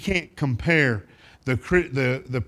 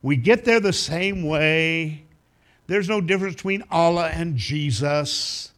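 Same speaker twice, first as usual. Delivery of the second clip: slow, higher-pitched mock platitudes; imitated lost person; 4 semitones higher than usual. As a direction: draw it out as an imitated naive believer. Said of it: "when a lost person says".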